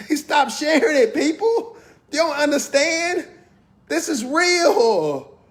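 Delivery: high, heated call-out; worked up and incredulous; getting hyped. Real, not acted. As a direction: climb up in pitch, half laughing at it. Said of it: "I'm getting hyped".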